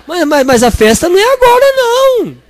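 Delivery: stammering, pitch jumping up on não; a host caught unprepared, half laughing; flustered host. Acted. Preacher playing the host telling guests they came too early.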